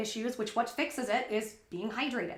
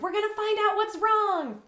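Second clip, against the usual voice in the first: high-pitched eager announcement; excited, retold; 10 semitones higher, 4 dB louder than usual. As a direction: act out hopeful excitement, pitch way up. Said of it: "I was so excited".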